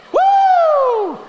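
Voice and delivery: high, loud whoop; mock cheer. Pastor mocking the crowd cheering Gideon, loud whoop.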